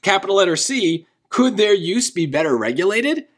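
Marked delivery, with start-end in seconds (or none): 1.3-3.3 "Could" stressed, then going up on "regulated"; question tune. Shown as a demonstration. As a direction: ask it for real, lifting "regulated" at the end. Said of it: "that is a question".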